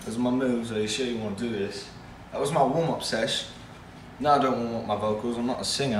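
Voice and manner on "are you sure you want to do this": ordinary talk, nothing marked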